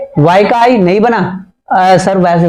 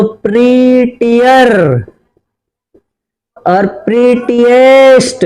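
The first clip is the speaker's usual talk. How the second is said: slow dictation; much slower than usual. Shown as a demonstration. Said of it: "superlative बना देता हूं".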